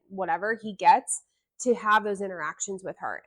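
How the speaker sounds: ordinary talk, nothing marked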